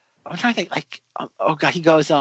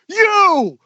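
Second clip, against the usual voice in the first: high loud mock bark at the girl; hammed-up shouted command; 13 semitones higher, 5 dB louder than usual. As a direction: bark the order. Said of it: "he points to her and goes".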